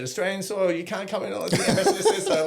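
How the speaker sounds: high, fast, laughing mock protest; acted breakneck high-pitched plea; frantic protest. Claiming sanctuary after the chase.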